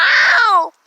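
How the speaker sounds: loud, high yelp; sharp yelp of pain. Real, not acted. Scratched by her cat, a sharp loud yelp. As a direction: yelp as the claws dig in.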